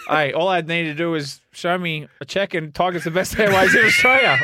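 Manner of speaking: hammed-up sponsor plug, voice rising to a shout; jokey commercial for Tiger Airways; mock ad pitch.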